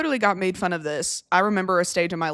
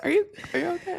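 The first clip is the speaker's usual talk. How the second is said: laughing concern; 9 semitones higher, 5 dB quieter, faster than usual. Real, not acted.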